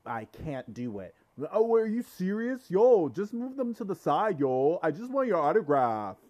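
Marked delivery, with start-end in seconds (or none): 1.5-6.2 high, loud excited fan voice; mock fan begging for an autograph; pushy fan. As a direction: play the fan who won't let him eat. Fan pestering a celebrity.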